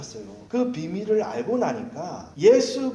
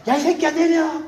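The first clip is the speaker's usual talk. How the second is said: high, loud mock shout; retold yell; 10 semitones higher, 5 dB louder than usual. Pastor recalling what the Sunday school kids saw, mock angry shout. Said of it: "소리 지르고 있더라고요".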